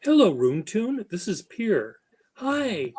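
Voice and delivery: high, bright sing-song hello; demo selfie greeting. Shown as a demonstration. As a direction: model a chirpy video greeting. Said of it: "I'll show you".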